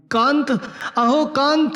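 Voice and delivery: high shrill scream of the name; wife shrieking in terror. A narrator's reading. Wife recoiling from a stranger, screaming high. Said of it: "ती खूप मोठ्यांदा किंचाळली".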